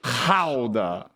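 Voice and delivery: Gouda with a throaty H-like start, HOW-da; the Dutch way to say Gouda, HOW-da. Shown as a demonstration. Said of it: "How to pronounce, okay, here it is".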